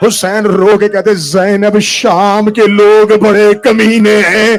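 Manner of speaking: wailing climax. Real, not acted. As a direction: build the grief to a sung wail. (روتے ہوئے بلند ماتمی لے)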